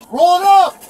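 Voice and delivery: loud, high angry demand; real heated shout; yelled order. Real, not acted. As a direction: holler it at the officer. Detainee yelling orders at officer.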